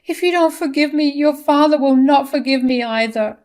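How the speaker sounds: high, wailing mother's plea; desperate pleading. A narrator's reading. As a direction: plead in distress, voice held very high. Mother begs son not to leave.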